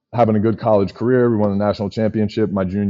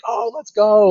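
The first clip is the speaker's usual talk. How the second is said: excited cheer; 12 semitones higher, slower than usual. Retold or imitated. Everybody cheering after the draft.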